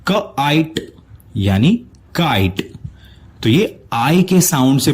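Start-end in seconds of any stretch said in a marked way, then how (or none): 0.1-0.8 क आइट said as two separate parts, क then आइट; slow split of क आइट into its sounds; sounds split apart. Shown as a demonstration. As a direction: pull क away from आइट like a phonics teacher. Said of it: "इसका sound बनेगा".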